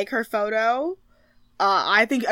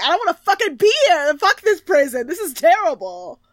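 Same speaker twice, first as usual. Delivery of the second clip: imitated outburst, pitched up and fast at the start; mock explosive rant; 6 semitones higher than usual. Retelling a contestant exploding in prison, mock angry rant. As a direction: play at blowing up in protest. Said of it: "like, explode and scream".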